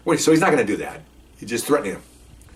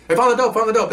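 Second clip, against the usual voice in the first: excited dog; 10 semitones higher than usual. Pretend.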